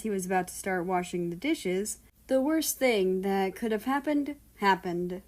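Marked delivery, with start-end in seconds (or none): none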